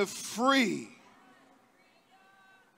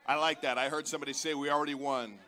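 ordinary delivery

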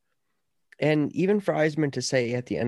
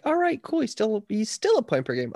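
lightly acted, higher-pitched reaction; imitated casual reaction; 8 semitones higher than usual. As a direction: voice a passing thought in a higher register. Voices own reaction to stats.

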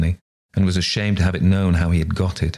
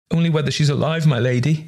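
much higher, a touch of weeping; tearful; 9 semitones higher than usual. A narrator's reading. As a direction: plead through tears, lightly voiced. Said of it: "with streaming eyes".

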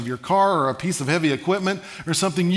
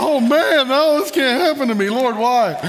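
high, loud mock despair; hammed-up plea from a terrified kid; scared; 8 semitones higher, 5 dB louder than usual. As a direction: wail it in comic panic. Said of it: "it just scared the life out of me".

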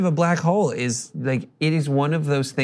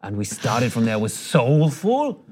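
[name]; 4 semitones lower, much slower than usual. Retold or imitated. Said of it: "if he had an accent, but he's like".

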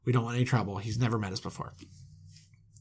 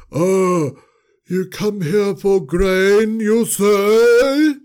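higher, louder put-on voice; a hammed-up disguised voice, higher and louder; disguised manager voice; 5 semitones higher, 6 dB louder, much slower than usual. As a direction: push the pitch up to mask who you are. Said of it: "he's almost disguising his voice".

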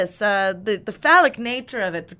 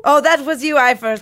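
put-on loud, theatrical old-timey hero; hammy stage actor; 3 semitones higher, 8 dB louder than usual. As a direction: declaim like a ham actor. Plays Braun in scene.

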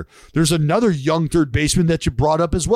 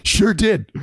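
brash, raised voice owning it; mock defiant yes, a bit louder; cocky confirmation; 5 semitones higher, 3 dB louder than usual. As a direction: fire back proudly at the fans. Player defiantly confirming to fans.

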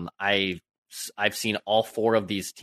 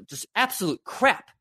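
exasperated rant; 11 semitones higher than usual. Real, not acted. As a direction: pitch climbing high into an exasperated crap. Fan venting about losing teams.

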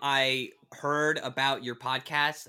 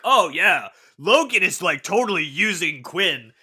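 loud, emphatic retold blurt; mock blurting; 4 semitones higher, 10 dB louder than usual. Actor retelling his interview slip to the host, loud and hammed-up. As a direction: blurt it out loudly, hamming up your own quote. Quoting own careless interview answer.

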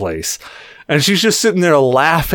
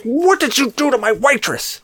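higher, accusing mock bartender; angry bartender; 12 semitones higher than usual. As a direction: demand it like a furious bartender. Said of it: "she's like".

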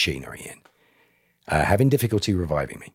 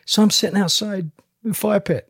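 caller voice; 3 dB louder than usual. Retold or imitated. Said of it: "they're like".

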